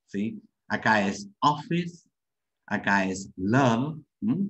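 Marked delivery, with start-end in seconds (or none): 1.4-2.1 "office" with an open English o, slowly. Shown as a demonstration. Teacher modelling English vowel in "office" slowly. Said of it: "el sonido de la O es diferente". 3.4-4.0 "love" said slowly with an a-like vowel, lav; English love. Shown as a demonstration. Teacher showing "love" sounds like an A. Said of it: "Que suena como una A".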